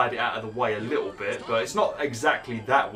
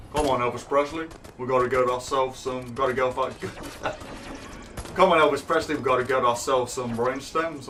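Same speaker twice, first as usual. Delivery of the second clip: Elvis voice. Pretend. Voicing [name] in game.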